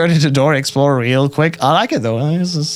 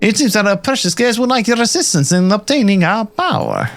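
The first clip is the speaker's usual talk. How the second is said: light put-on German accent, higher; 8 semitones higher than usual. Villain talking of the precious guest, light German accent. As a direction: try a rough German version, pitched up. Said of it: "do this more German version of this".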